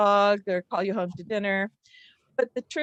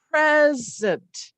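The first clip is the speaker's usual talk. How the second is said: "present" with PRES punched high and loud; stress on PRES; 4 semitones higher, 6 dB louder than usual. Shown as a demonstration. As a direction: punch the first syllable of "present", let the second drop. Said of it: "a stress is that punch".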